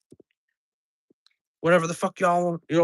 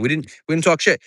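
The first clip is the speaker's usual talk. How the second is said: ordinary talk, nothing marked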